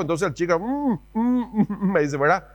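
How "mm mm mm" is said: high muffled mm through a shut mouth; imitating a child; closed-mouth hum. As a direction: hum like a child who can't open his mouth. Child trying to eat mouth shut.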